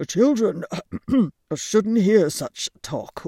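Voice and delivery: loud stern fatherly scold; stern father owl. Acted. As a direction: scold the children firmly.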